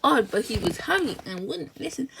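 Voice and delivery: ordinary delivery, nothing marked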